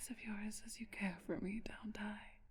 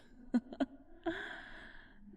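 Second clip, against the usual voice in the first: airy laugh rising higher; breathy mocking laugh; 12 semitones higher than usual. Dying immortal mocks an infatuated visitor with a rising breathy laugh. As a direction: let a wheezy, whispered laugh climb upward.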